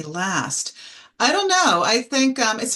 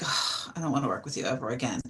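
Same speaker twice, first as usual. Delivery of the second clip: fast, unvoiced muttering, much softer; muttered under breath; 7 semitones lower, 7 dB quieter than usual. Colleague fed up with a jerk, muttering fast under her breath. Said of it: "I'm like".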